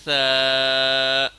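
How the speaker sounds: ثا said softly with the tongue tip at the teeth; ثا soft, tongue touching the tooth edges; soft tha. Shown as a demonstration. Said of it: "ثا کو نرم پڑھنا ہے".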